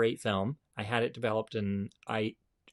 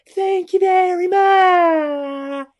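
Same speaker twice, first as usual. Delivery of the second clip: very high, very loud, slow squealing frog; big mouth frog voice; 14 dB louder, much slower than usual. Acted. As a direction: shout it like a huge-mouthed cartoon frog. Frog thanks raccoon.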